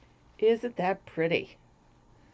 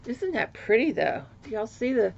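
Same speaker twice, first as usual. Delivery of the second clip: ordinary talk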